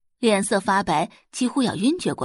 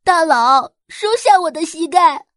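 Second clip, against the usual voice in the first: higher, louder worshipful shout; gushing praise; 8 semitones higher, 7 dB louder than usual. A narrator's reading. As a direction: cry out in awe. Forum user worships the hacker.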